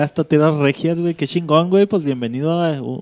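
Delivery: ordinary talk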